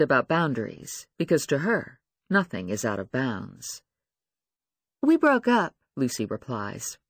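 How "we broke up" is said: put-on insecure young woman. Lucy voiced, acting hurt.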